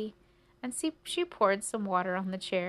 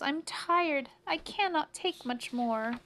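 weary mouse; 7 semitones higher, slower than usual. Acted. Tired mouse pleads.

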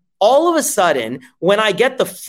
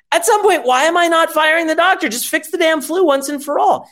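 exasperated outburst; 10 semitones higher than usual. Acted. Fed up with doctor not fixing.

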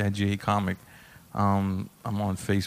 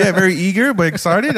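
retold host banter; 7 semitones higher, 6 dB louder than usual. Host humours an eager heckler.

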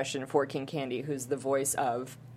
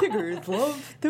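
Tigger voice; 5 semitones higher than usual. Pretend. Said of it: "do a Tigger impression".

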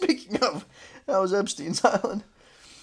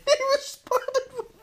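laughing fit. Real, not acted. Laughing fit mid-story.

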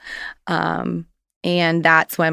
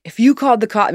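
brother's threat yelled, a bit louder and higher; mild mock yell; 6 semitones higher, 6 dB louder, faster than usual. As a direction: retell the angry threat with a raised voice, not full force. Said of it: "he's yelling".

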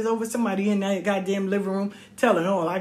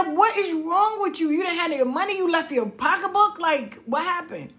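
indignant questioning; 9 semitones higher than usual. Retold or imitated. Child to mother on the step, indignant disbelief. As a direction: act out a kid confronting a parent.

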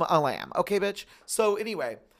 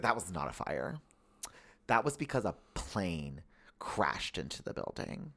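lower, slower, matter-of-fact mom explaining; mom impersonation; 7 semitones lower, 8 dB quieter, slower than usual. Retold or imitated. Son imitates his mom explaining the crash, lower and patient. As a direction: lightly play mom setting the record straight. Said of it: "hear me do this impersonation of you".

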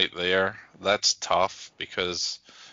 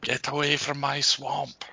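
higher, slow Scottish ogre voice; playful movie-ogre impression, pitched up; Shrek; 6 semitones higher than usual. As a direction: draw it out slowly as a Scottish ogre. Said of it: "share a Scottish accent with Shrek".

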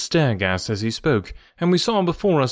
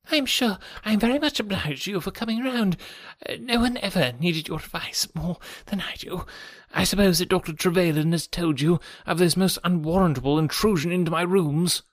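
higher, reedy, fussy voice; nervous resident patient; 6 semitones higher than usual. A narrator's reading. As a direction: fuss politely in a thin, anxious voice.

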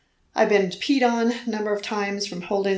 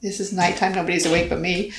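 ordinary talk

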